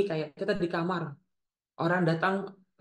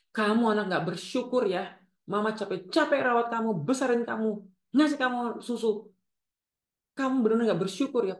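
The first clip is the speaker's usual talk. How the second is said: higher-pitched reproachful mother; emotional blackmail; 4 semitones higher than usual. Acted. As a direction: act out guilt-tripping your child. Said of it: "Memeras secara emosional itu seperti ini". (ibu mengungkit jasa, menyalahkan anak)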